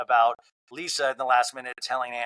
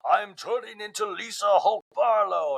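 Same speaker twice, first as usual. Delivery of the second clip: higher, slow, put-on voice; triggered Lisa; 7 semitones higher, slower than usual. Triggered Lisa mocks herself, higher and slow. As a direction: drag out the fury in a funny voice. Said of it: "gets triggered".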